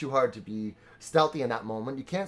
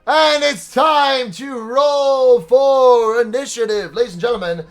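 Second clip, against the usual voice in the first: dramatic announcement; 8 semitones higher, 10 dB louder than usual. Acted. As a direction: boom it out like a fight announcer.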